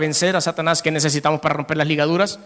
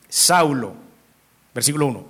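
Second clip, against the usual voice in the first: ordinary delivery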